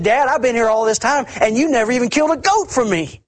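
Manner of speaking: high, pleading mock voice of the older son; whiny older son. Older son manipulating his father, high mock whine. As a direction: wheedle like a spoiled son working his dad. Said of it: "he's manipulating his dad".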